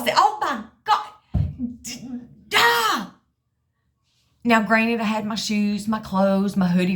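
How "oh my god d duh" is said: squealed shock. Retold or imitated. Shock at scale weight.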